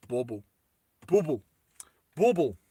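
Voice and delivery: mock Russian accent on a single word; lightly put-on Russian "Bauble". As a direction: try "Bauble" with a Russian accent, short vowel. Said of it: "I need to say it in a Russian accent".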